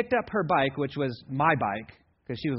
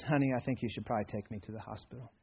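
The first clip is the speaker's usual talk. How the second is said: softer, flat, matter-of-fact; calm understatement; 5 semitones lower, 9 dB quieter, faster than usual. Retold or imitated. Dad with a sawn finger telling mom, calmly soft and flat. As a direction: say it like nothing is wrong. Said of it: "he said in that tone of voice".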